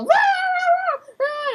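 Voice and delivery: very high-pitched imitated barking; high yapping "rah", fading off; barking dog. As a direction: keep barking like a worked-up dog. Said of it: "Jenny was still".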